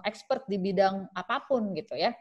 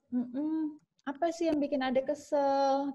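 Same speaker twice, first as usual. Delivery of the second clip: soft coaxing mother; 6 semitones higher, 5 dB quieter, slower than usual. Shown as a demonstration. Mother coaxing child to talk.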